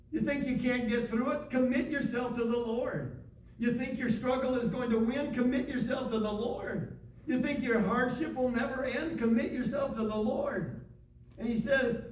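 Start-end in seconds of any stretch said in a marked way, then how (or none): 0.1-10.9 rising, insistent preacher's refrain; fervent sermon build; preaching. Acted. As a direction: press each answer home like a pulpit call. Preaching call and response.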